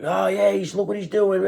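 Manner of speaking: high-pitched mock busybody chatter; pitchfork mob. Pursuers spying on the hermit in the woods, high mock accusation.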